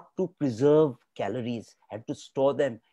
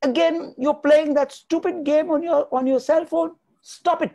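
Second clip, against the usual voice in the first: much higher and louder, exasperated scolding; scolding parent; 12 semitones higher, 6 dB louder than usual. Acted. Parent scolding a child over a phone game, exasperated. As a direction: snap at the kid to put the phone away. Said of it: "what we do is we say".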